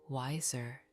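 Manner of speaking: ordinary delivery, nothing marked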